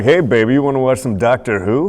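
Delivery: hammed-up smooth talk to a lady; funny sexy voice. Shown as a demonstration. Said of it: "That's my Space Dandy voice".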